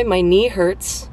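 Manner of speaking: normal talking voice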